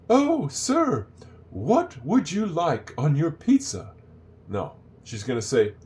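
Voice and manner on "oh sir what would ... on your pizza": high, slow, hammed-up polite waitress; mock a prim waitress, every word drawn out; overly proper waitress. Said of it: "waitress is not gonna come up and say".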